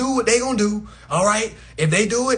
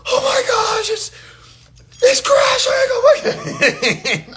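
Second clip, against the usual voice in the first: shrill high mock panic, laughing through it; mock panicked screaming; 13 semitones higher, 4 dB louder, slower than usual. Uninformed people screaming about a bank crash, mock panic. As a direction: scream like the world is ending, half laughing. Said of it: "always gonna be screaming".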